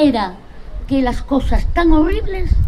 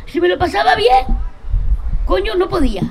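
higher, exclaiming; exasperated outburst; 6 semitones higher than usual. Real, not acted. Telling friends she couldn't enjoy herself, exasperated exclamation. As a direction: vent the frustration with a raised voice. Exasperated about her inner conflict.

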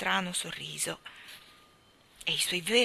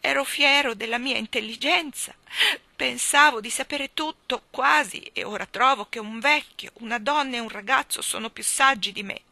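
weeping king; 5 semitones higher, 8 dB louder than usual. Acted. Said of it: "disse piangendo".

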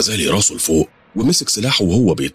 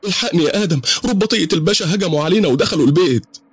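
much higher, panicked woman's scream; screaming for help; 13 semitones higher than usual. Acted. Dida screaming down the phone to Adam for help. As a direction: cry out for rescue in terror. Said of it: "صرخت بصوت عالي وقالت".

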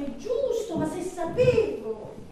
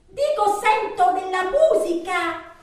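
louder, drawn-out repeat down the phone; insistent repeat; 6 dB louder, slower than usual. Acted. Woman repeating herself to her lover on the phone, loud and insistent. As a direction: raise your voice so the listener gets it.

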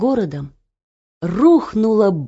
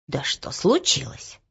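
quiet concern; 6 semitones lower, 7 dB quieter than usual. Acted.

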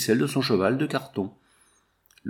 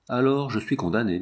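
ordinary delivery

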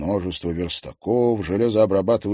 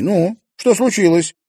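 worried question; 12 semitones higher, 8 dB louder than usual. Acted. Marina comforts crying sister.

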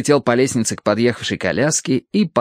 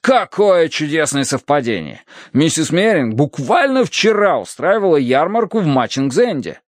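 lively, higher-pitched delight at a coincidence; exclaiming; 6 semitones higher than usual. A narrator's reading. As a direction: seize on the coincidence eagerly. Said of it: "воскликнул я". (оживлённо, с восторгом)

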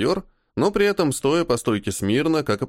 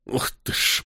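muttered curse; 4 dB quieter than usual. A narrator's reading. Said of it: "Выругался, не выдержав".

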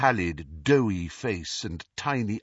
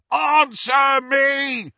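high, slow furious bellow; 8 dB louder, much slower than usual. A narrator's reading. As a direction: bellow it at the door. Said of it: "Amycus bellowed".

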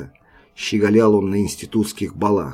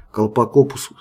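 usual speaking voice

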